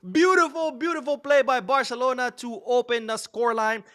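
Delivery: high, thrilled praise after the goal shout; elated. Real, not acted. Commentator praising Barcelona opening the scoreline, elated. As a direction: stay way up high, still buzzing from the goal.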